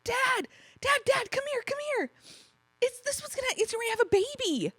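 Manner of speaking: put-on high, eager child calling her dad; excited little girl. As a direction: call out like a thrilled kid.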